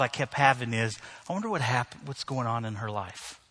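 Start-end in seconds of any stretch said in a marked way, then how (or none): 1.3-3.4 soft, low musing; hushed, compassionate reflection; quiet wondering. Retold or imitated. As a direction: voice a gentle private thought, trailing down softly. Voicing his compassionate private thought.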